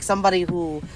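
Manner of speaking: usual speaking voice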